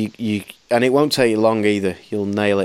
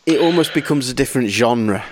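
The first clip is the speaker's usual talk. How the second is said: ordinary delivery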